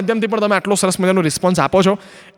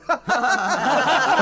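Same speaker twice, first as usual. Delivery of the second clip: burst of laughter; 5 semitones higher, 6 dB quieter than usual. Real, not acted. Laughing at own punchline.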